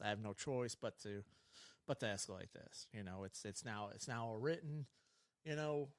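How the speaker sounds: low, soft, matter-of-fact explanation; subdued supervisor's talk, retold; retold calm write-up. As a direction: quietly lay out the bad news.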